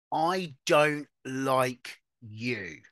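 very slow, measured confrontation; slow, deliberate declaration. Acted. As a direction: spell it out word by word. Models a blunt confrontation.

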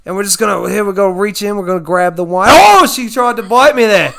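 loud, high-pitched Australian showman; excited Aussie nature host presenting a wild catch; Steve Irwin. Acted. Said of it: "Steve Irwin curses a lot".

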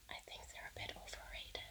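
whispered confession; hushed, secret aside. Real, not acted. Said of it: "I don't know if I can say this".

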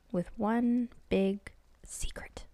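slow, hushed whisper; slow conspiratorial whisper to the listener; secretive whisper. A narrator's reading. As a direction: lean in and breathe it like a secret. Narrator hints at a dark secret.

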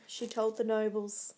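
usual speaking voice

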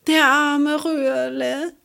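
high, slow grieving cry; tearful. Acted. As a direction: mourn a lost friend. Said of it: "wischte sich ... die Tränen".

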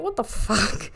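ordinary delivery

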